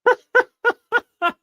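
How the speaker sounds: a high, breathless burst of laughter; high giddy laugh. Real, not acted. Streamer laughing at the milestone, high giddy laugh.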